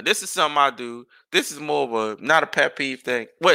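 usual speaking voice

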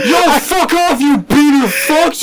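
high-pitched, indignant mock yell; imitated protesting kid. Cornered kid tells onlookers to leave, mock angry yell. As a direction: act out a kid yelling from the stall. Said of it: "And you hear him".

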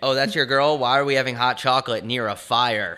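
loud hyped-up taunt; loud teasing. Pretend. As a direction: shout it out as a gag. Repeated bit, louder.

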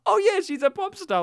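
high, loud burst of recognition; hammed-up sudden reminder; mock realization. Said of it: "it just made me go".

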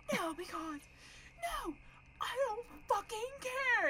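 very high wailing protest, imitated; upset teary guy. Imitating the neighbor yelling at friends, high tearful wail. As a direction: mimic scream-crying, pitch climbing. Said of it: "he's talking like this".

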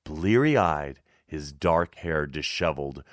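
ordinary delivery

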